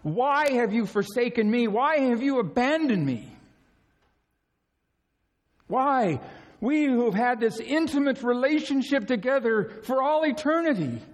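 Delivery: high, pleading cry of abandonment; retold anguished cry from the cross. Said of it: "he cried out".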